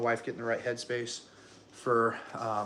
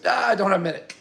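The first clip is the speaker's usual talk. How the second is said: loud, higher-pitched imitation of rushed doctor; urgent retort; 7 semitones higher, 9 dB louder, faster than usual. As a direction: fire back loudly, pressed for time. Urgent doctor answers back, retold.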